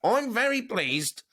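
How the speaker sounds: put-on high, slow stab at a Liverpool accent, gives up on it; a clumsy Scouse accent, pitched well up and dragged out; failed Liverpool accent, sliding up high; botched Alexi impression. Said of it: "I can't do the accent".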